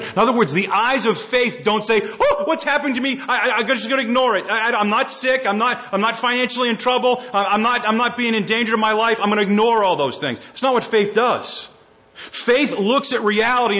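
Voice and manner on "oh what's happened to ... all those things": higher, hurried stammering parody; mock flustered voice, no real panic; mocking denial. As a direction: lampoon someone burying their head in the sand.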